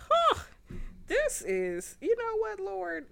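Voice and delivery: high, loud huff, then squeaky protest; exasperated. Retold or imitated. Mom recalling her reply to God's correction, mock exasperation. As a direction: throw up a high-pitched, mock-exasperated complaint. Acting out own exasperated reaction to God.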